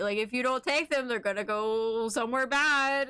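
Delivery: ordinary talk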